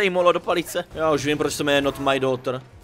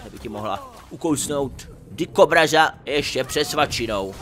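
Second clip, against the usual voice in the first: usual speaking voice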